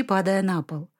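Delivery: plain reading voice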